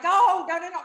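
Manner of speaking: high, loud repeated no; panicked refusal. Retold or imitated. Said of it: "I'm like".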